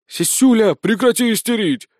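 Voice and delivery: high, loud reprimand; indignant. A narrator's reading. Companion orders the sergeant to stop the hysterics, indignant. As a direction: tell off a hysterical comrade. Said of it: "возмутился Спирт".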